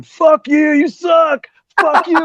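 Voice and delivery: high, loud mock shouting; imitated yelled abuse; heckling. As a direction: heckle the player from right in front. Retelling heckler abusing him during audition.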